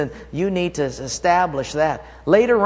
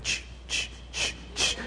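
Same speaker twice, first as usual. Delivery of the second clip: unvoiced rhythmic ch ch breaths; imitating steady breathing; 11 dB quieter than usual. Friend jogging up behind, rhythmic breath sound. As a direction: mimic a steady jogger's breathing. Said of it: "heard my friend behind me".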